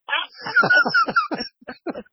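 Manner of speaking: real, high cackling laugh; high-pitched laugh. Real, not acted. Storyteller cracking up at Slayer blocking the Obsessed, high real laughter. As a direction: burst out in a long, high laugh.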